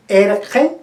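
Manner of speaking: "erge" with i opened to e by a back consonant. Shown as a demonstration. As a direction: say "erge" with the g far back in the throat. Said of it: "es el que se ha abierto mucho más".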